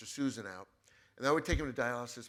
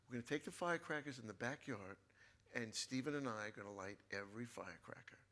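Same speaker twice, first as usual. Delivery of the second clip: hushed, whispered father's secret plan, softer; conspiratorial dad; 8 dB quieter than usual. Retold or imitated. Dad tells son they'll light firecrackers, whispered. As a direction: let him in on a secret, under your breath.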